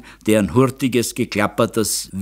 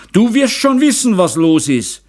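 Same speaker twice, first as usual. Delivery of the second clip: high, loud burst of anger; angry shout; 10 semitones higher, 5 dB louder than usual. A narrator's reading. Father shouts at his daughter without turning round, angry. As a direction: shout it accusingly at your daughter. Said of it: "schrie Meister Zauner".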